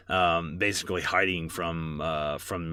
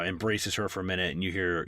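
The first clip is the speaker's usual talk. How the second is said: usual speaking voice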